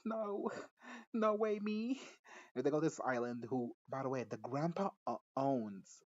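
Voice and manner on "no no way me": high-pitched, hammed-up disbelief; exaggerated "who, me?" astonishment; mock shock. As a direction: act shocked, pitch shooting way up. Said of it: "She's so shocked".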